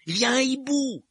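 high, loud alarmed cry; shouting. A narrator's reading. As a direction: yell the warning in alarm. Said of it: "s'écria Harry".

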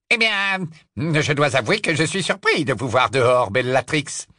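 lightly voiced suave Death Eater; a hint of a smug wizard; Travers voice.

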